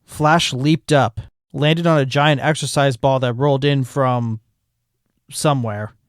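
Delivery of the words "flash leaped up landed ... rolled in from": normal talking voice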